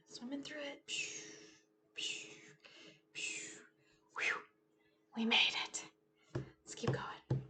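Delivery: soft whisper; quiet whispered encouragement; whispered relief. Acted. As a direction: hush it like sneaking on. Sneaking through story obstacles.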